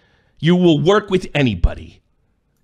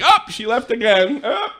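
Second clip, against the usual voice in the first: ordinary delivery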